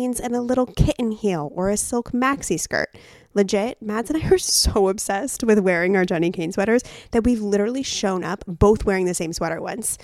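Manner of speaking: loud podcast ad delivery; ad read. Acted. Co-host recommending sweaters to listeners, emphatic ad read. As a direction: push each product detail with polished energy. Reads a sponsor ad.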